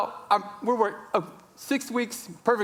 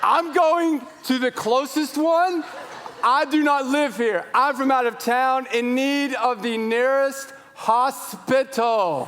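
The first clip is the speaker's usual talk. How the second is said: high, loud, slow and emphatic; exasperated shouting; 8 semitones higher, 4 dB louder, slower than usual. Retold or imitated. Out-of-town father to unhelpful bystander, exasperated shout. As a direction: spell it out loudly to someone useless.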